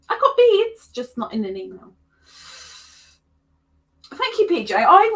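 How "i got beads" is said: high, loud gleeful boast; triumphant. Acted. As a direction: crow it out in triumph.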